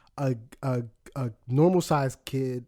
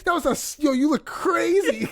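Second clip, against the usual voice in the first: mom laughing at son; 13 semitones higher than usual. Retold or imitated. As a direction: tease him like his laughing mother. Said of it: "'Cause she was like".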